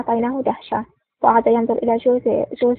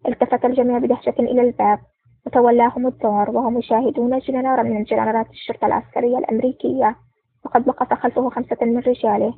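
plain reading voice

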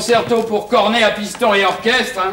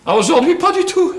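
loud, high-pitched admission; emphatic denial; 10 semitones higher, 5 dB louder than usual. Acted. Broke husband answers about money, emphatic. Protests having no money at all.

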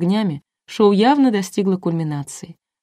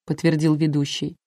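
usual speaking voice

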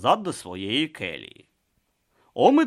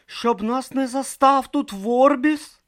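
anxious question; 5 dB louder, slower than usual. Acted. Brutha asks Om.

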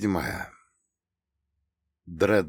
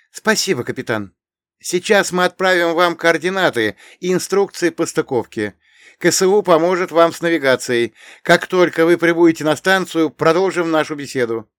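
grateful heroine; 9 semitones higher, 8 dB louder than usual. Acted. Irina thanks captain.

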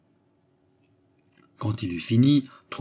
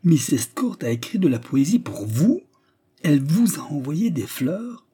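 high, incredulous rising questions; unflattering astonishment; 6 semitones higher, 3 dB louder than usual. A narrator's reading. Manager eyeing the unlikely suitor, astonished. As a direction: question it in open disbelief. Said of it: "avec un étonnement des moins flatteurs".

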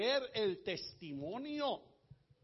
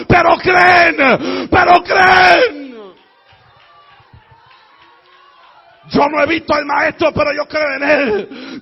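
shouted, relentless preaching, much louder; fervent high-volume sermon shout; full-force preaching; 7 semitones higher, 24 dB louder than usual. Real, not acted. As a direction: preach at the top of your lungs, phrase after phrase.

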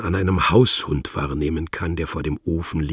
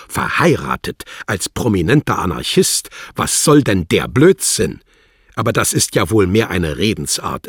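louder, higher scornful diplomat; mocking official; 3 semitones higher, 7 dB louder than usual. Wladimir ridicules the married anarchist, loud and scornful. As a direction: scoff at him.

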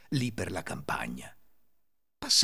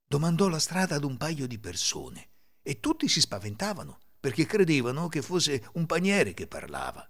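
usual speaking voice

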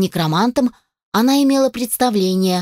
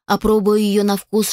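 ordinary delivery, nothing marked

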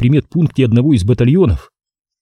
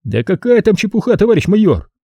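higher, protesting exclamation; exclaiming captain; 9 semitones higher than usual. A narrator's reading. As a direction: throw it back brightly. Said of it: "воскликнул он".

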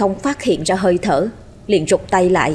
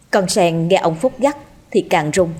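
ordinary delivery

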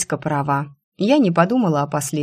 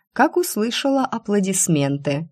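plain reading voice

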